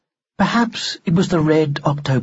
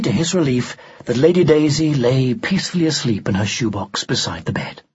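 usual speaking voice

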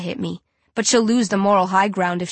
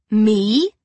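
mock gasp; 4 dB louder than usual. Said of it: "I gasp".

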